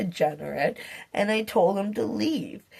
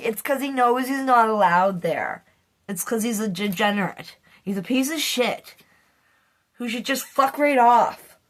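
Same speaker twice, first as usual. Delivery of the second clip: ordinary delivery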